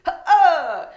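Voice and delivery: loud high grunt on the serve; loud mock tennis shriek. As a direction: let out a Serena-style hit grunt. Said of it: "I think Serena Williams".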